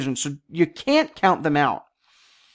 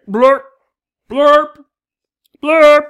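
loud silly blurps, rising; loud blurp noises; 9 semitones higher, 9 dB louder, much slower than usual. Real, not acted. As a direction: belch out a blurp. Curing hiccups with odd noises.

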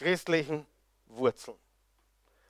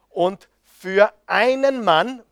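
"einen" stretched slow and weighted; stress on einen; 3 semitones higher, much slower than usual. Shown as a demonstration. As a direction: lean hard on "einen", slowly. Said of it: "Betonung auf einen".

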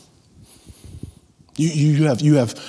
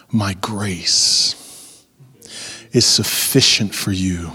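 low, hushed voice of God with long pauses; solemn answer; 7 semitones lower, 5 dB quieter than usual. Acted. The Lord telling Paul no but promising grace, hushed and low.

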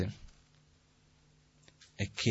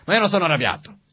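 higher, louder, indignant snap; angry denial; 8 semitones higher, 7 dB louder, faster than usual. Retold or imitated. Angry person denying anger, retold as a loud higher snap. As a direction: insist you're not angry while clearly angry. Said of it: "sta parlando in un modo arrabbiato".

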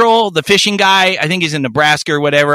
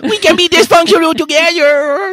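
put-on baby talk; 12 semitones higher than usual. Recalling sweet-talking his friend Kathy, in high baby talk. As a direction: coo it like talking to a toddler. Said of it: "baby talk on me".

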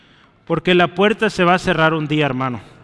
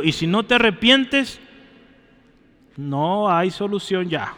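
ordinary delivery

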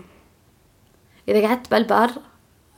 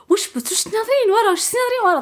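much higher, puzzled mock question; imitating friends' question; 12 semitones higher than usual. Friends at the campfire asking her, high mock question. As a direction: mimic friends asking what she stares at. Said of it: "اللي يشوفن يقول".

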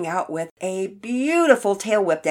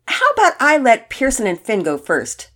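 louder, higher, generous offer; magnanimous suggestion; 5 semitones higher, 8 dB louder than usual. A narrator's reading. Todd letting Pearson and Finn go first, magnanimous. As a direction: grandly offer to let the others go ahead. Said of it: "Todd magnanimously suggested".

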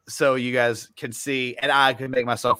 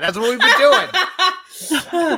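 high, slow laughing words; 11 semitones higher, 3 dB louder, much slower than usual. Real, not acted. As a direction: laugh through the line. Laughing while explaining.

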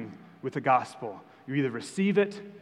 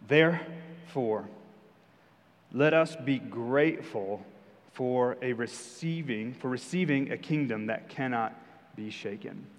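slow, deliberate Bible reading; scripture reading; 3 semitones lower, 3 dB quieter, slower than usual. Acted. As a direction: read the verse slowly, giving weight to each phrase. Reads scripture aloud.

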